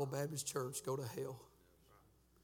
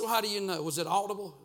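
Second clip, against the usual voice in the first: louder, higher challenge from a listener; questioning listener; 4 semitones higher, 7 dB louder, faster than usual. Acted. Preacher voices a listener asking how he knows, loud questioning. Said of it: "You say".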